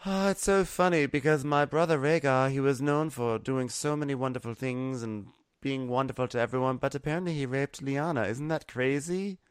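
high-pitched, chatty mock royal; Khaleesi. Khaleesi telling Jon about her brother Rhaegar, high and gushing.

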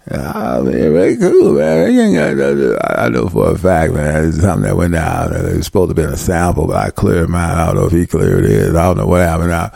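low, mumbling slurred rapper voice. Retold or imitated. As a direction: mumble low and slurred, half swallowing words.

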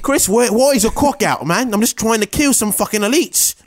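high, indignant gamer voice; high mock friend voice. Friend voice in bit.

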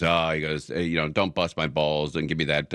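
ordinary talk, nothing marked

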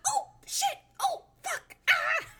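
very high flustered mom; mom caught out. Retold or imitated. Mom realizes she let the secret slip, high flustered curse. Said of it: "my mom was like".

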